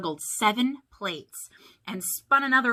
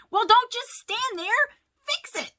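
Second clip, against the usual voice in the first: very high, loud frantic command; urgent order; 6 dB louder than usual. A narrator's reading. Mike ordering monsters to fix the meter, frantic. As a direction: order them into action in a panic.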